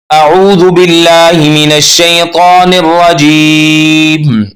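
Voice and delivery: slow, melodic Quran recitation; tajweed recitation. Shown as a demonstration. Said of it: "सही तलफ्फुज के साथ". (तजवीद के साथ धीमी तिलावत)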